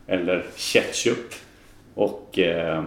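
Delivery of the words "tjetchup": "ketchup" slowly, starting on tje; soft tje-sound. Shown as a demonstration. Guest giving example of soft-vowel rule, tje in "ketchup". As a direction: say "ketchup" like "tjetchup". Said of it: "så uttalar man ju ett K-ljud".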